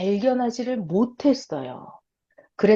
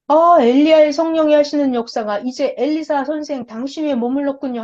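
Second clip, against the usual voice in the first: awed disciples; 5 semitones higher, 4 dB louder than usual. Acted. Disciples address Elisha.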